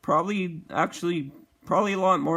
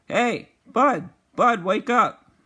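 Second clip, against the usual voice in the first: calling out, rising louder and higher; retold wake-up call; 5 semitones higher, 4 dB louder, slower than usual. Trying to wake unconscious friend after the crash, urgent calling. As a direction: try to rouse someone, raised urgent voice. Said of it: "I'm trying to wake him up".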